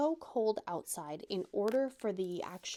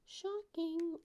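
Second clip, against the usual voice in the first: mock surprise; 9 semitones higher, 4 dB quieter than usual. Real, not acted.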